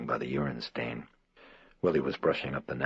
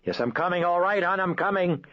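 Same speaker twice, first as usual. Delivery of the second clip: calling out; 6 dB louder than usual. Acted. Mother runs to screaming child.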